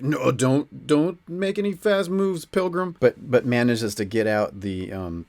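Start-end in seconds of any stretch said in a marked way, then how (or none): 0.0-3.0 lightly acted cop's warning, pitch rising; police warning. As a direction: warn someone off like a mock policeman. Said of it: "the police are like".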